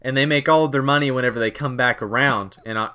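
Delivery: usual speaking voice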